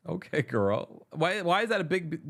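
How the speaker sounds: ordinary talk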